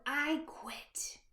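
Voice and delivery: breathy whispered pout; sulky whisper. Acted. Mermaid sulkily giving up on the crown. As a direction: drop to a pouting whisper. Sulky quiet quit after shout.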